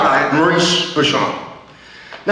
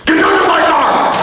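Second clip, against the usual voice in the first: higher and louder; louder yelled command; shouted order; 9 semitones higher, 3 dB louder than usual. Retold or imitated. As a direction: bark it out loud.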